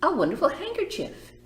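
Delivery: high, delighted announcement; big reveal. Acted. Grandpa showing Joseph the handkerchief, delighted. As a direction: reveal with wonder.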